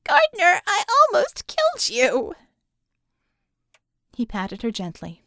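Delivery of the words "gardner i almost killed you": high, loud, anguished crying out. Acted. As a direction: break down on his name. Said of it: "She started crying again".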